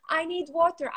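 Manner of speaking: a hint of a whiny toddler; lightly voiced little girl; child's plea. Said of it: "she was like".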